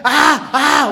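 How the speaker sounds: high loud groan of pain; hammed-up moan of a woman in labour; mock labour groan. Sister-in-law groaning while scolding the priest.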